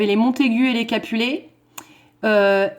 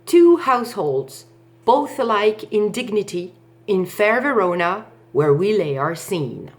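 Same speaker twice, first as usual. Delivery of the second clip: measured verse recitation. Shown as a demonstration. Teacher modelling the prologue for pupils to repeat, measured recitation. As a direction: recite it line by line on the beat. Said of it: "ça devrait donner en répétition la chose suivante".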